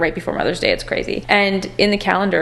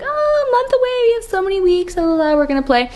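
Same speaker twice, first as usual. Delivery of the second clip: much higher, breezy la-la-la carefree voice; mock the naive relaxed planners; carefree sing-song; 12 semitones higher than usual. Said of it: "We were all like".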